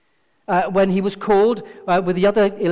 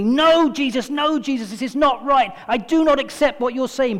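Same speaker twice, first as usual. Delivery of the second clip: emphatic Peter; 5 semitones higher than usual. Acted. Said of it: "Peter insisted emphatically".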